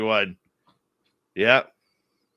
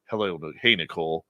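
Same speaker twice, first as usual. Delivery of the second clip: low voice; 9 semitones lower, 6 dB quieter, faster than usual. Retold or imitated. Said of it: "he sure as hell says".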